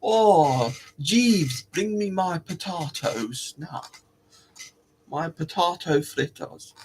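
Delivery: mock posh accent, drawn-out "potahto"; hammed-up posh master summoning a servant; posh git. Posh git ordering Jeeves to fetch food, mock posh. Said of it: "you think you're a really posh git".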